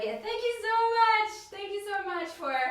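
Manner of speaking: high-pitched, gushing gratitude; effusive thanks. Real, not acted. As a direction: thank them in a high, sing-song gush. Effusive thanks to those joining.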